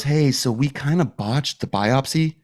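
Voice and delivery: lightly voiced apologetic doctor; imitating awkward doctor. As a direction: break bad news too casually, as the doctor. Acts out doctor's awkward phone call.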